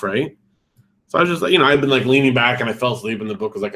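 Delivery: usual speaking voice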